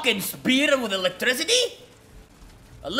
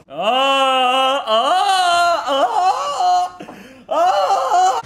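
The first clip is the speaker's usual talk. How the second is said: repeated yells climbing higher and louder; rising screams; 7 semitones higher, 4 dB louder, much slower than usual. Real, not acted. Player reacting mid-fight, rising screams. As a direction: cry out again and again, each higher.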